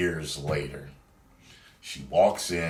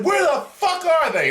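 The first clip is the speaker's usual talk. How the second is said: much higher, louder mock shrieking girlfriend; imitating angry girlfriend; 8 dB louder than usual. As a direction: play the jealous girlfriend losing it, high and loud. Girlfriend demanding, retold.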